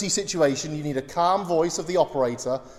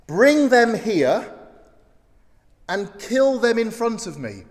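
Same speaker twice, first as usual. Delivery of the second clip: stern king's command; 4 semitones higher, 4 dB louder than usual. Acted. King orders enemies killed.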